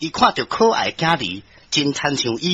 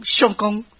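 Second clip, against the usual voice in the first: higher, plaintive woman's voice; pleading wife; 3 semitones higher than usual. Acted. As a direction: implore the husband who left you. Wife pleads with her husband. (苦情妻子哀求翁婿)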